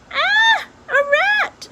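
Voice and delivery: shrill high scream of fright. A narrator's reading. Princess screaming at the rat in her room, terrified shriek. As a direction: shriek out in sudden terror. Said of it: "she screamed".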